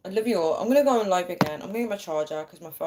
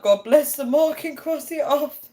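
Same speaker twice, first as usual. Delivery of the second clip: put-on high, loud recital as a kid; imitated childhood recital; 9 semitones higher, 5 dB louder than usual. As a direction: recite it like a child obeying mum, pitched up and loud. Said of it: "we'd have to be like".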